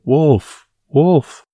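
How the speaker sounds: high, loud scream of terror; terrified shout. Acted. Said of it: "In terror".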